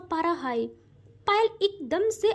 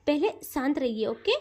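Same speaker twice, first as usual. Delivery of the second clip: calming; 6 semitones lower, 3 dB quieter than usual. Acted. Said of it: "Avani कहती है".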